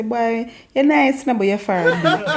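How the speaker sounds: flatter, then tease quickly in patois; Jamaican patois tease. Shown as a demonstration. Complimenting then teasing a friend in Jamaican patois. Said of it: "you can say".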